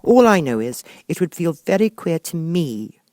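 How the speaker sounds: put-on a child insisting; Alice. A narrator's reading. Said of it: "said Alice".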